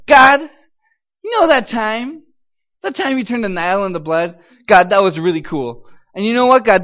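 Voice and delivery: loud call, then persuasive coaxing; butter God up with an eager plea. Retold or imitated. Captive reminding God of past miracles, coaxing.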